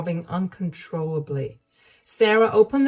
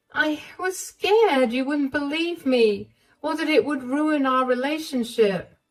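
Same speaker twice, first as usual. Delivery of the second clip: higher, fearful confession; Emma's scared voice; 9 semitones higher than usual. Acted. Emma telling Sarah why she stayed silent, higher and fearful.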